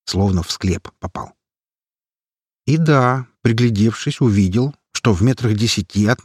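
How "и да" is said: ordinary delivery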